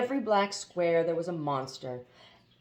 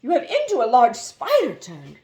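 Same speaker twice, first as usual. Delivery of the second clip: put-on louder, childish princess cry; infant voice; 6 semitones higher, 9 dB louder than usual. Princess crying out to the spider, in an infant voice. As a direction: squeal it like a babyish little princess. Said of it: "cried out in an infant voice".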